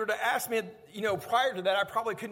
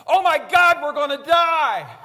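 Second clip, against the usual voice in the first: high, loud scream of terror; Rita's panicked yell; 7 semitones higher, 14 dB louder, slower than usual. Retold or imitated. Rita yelling in fear as the car spins back toward them. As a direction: shriek it like the car is coming back. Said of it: "And Rita yells".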